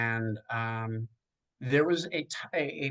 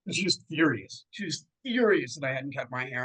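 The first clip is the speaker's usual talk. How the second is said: ordinary delivery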